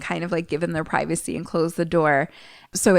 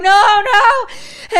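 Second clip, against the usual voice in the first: high, loud mock wail; imitating a distraught dad; acted anguished cry; 14 semitones higher, 6 dB louder than usual. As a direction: cry out in alarm, pitched way up. Retelling Dad's cry.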